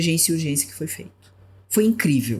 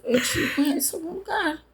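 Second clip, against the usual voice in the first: higher, lightly acted own line; casual recognition, a touch higher; quoting herself; 10 semitones higher, 4 dB quieter than usual. To masked colleague, a hint of higher curious voice. Retelling meeting former colleagues.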